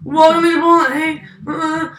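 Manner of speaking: mock greeting that falls apart into mumbling; garbled store greeting. Worker showing how they greet customers, garbled greeting. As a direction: start high and perky, then fumble it. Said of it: "it comes out a lot like that".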